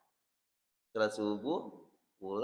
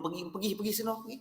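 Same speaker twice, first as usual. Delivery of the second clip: higher, rising shoo-shoo; wife shooing her husband out the door; mock shooing; 9 semitones higher, 5 dB quieter, faster than usual. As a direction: wave him off quickly.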